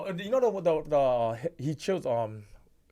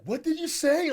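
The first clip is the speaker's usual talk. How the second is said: confused shout; 13 semitones higher, 5 dB louder, slower than usual. Retold or imitated. Imitating confused requester.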